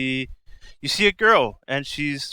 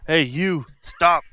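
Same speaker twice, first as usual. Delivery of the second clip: loud command to halt; shouting guard; 4 semitones higher, 6 dB louder, slower than usual. Acted. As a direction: bark it like a guard stopping intruders. Game master voices guard shouting.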